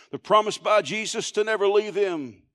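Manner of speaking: ordinary talk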